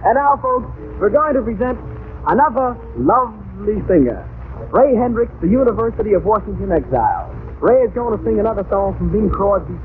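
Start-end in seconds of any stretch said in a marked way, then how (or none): none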